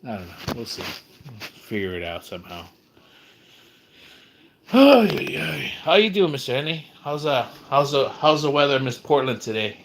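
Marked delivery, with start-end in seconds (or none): 4.7-9.8 mock greeting voice. Greeting someone in a put-on voice.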